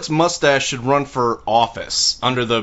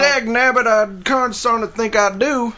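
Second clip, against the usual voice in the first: high-pitched mock prospector; Western old-timer; 7 semitones higher than usual. Co-host answering the cowboy host as a high-pitched old-timer.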